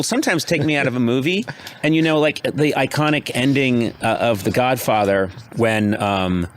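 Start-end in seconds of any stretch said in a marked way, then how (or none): none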